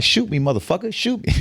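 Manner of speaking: retold high defiant taunt. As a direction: throw the dare back at him, pitch rising. Retold taunt during a gun standoff.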